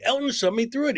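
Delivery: high-pitched retold excuse; acted high complaint; protesting kid. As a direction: insist on innocence like a kid. Retold kid arguing with dad.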